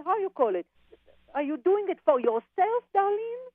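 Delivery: ordinary delivery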